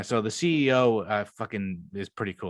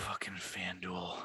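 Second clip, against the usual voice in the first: slow, softer whispered grumble; whispered curse; 3 semitones lower, 14 dB quieter, slower than usual. Real, not acted. Cursing the betting app while pulling it up, whispered. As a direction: curse the app under your breath.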